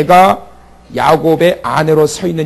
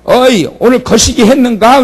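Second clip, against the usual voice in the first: loud, high Jeolla country greeting; 전라도 사투리로 크게; Jeolla dialect; 7 semitones higher, 5 dB louder than usual. Retold or imitated. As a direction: call out like a Jeolla neighbor. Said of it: "전라도 사투리 중에서는".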